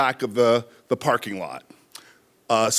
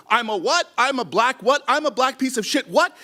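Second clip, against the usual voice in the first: taunting; 8 semitones higher than usual. Retold or imitated. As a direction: taunt him to come closer. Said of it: "my taunt thing of".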